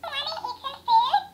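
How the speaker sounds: high squeaky sped-up echo of the toy; a tiny pet toy chirping the words back; toy chipmunk echo. Real, not acted. Talking pet toy repeating a girl's greeting, high and squeaky.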